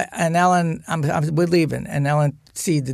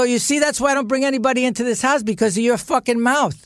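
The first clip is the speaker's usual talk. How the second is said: fast, high-pitched mock outburst; retold anger; 7 semitones higher, faster than usual. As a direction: act out blaming her in a rush. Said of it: "I get so mad at her".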